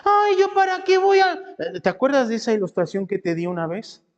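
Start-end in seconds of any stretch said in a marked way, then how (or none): 0.0-1.3 high-pitched yelled complaint; gritoneando. Acted. Complainer refusing to go to church, high mock yelling. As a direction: yell it high, like a fed-up complainer. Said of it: "todos gritoneando".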